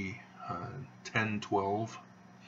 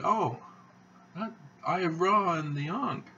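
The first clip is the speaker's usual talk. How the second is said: higher, louder surprised "Oh", retelling the sister; a woman caught off guard by a shirt, imitated higher; surprised exclamation; 9 semitones higher, 4 dB louder than usual. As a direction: mimic her surprise, pausing on "Eye of Ra". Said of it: "She sort of hesitated on Eye of Ra".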